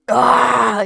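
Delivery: loud, high agonised scream; scream of pain. Acted. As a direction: shriek as the bolt hits. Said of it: "he screams".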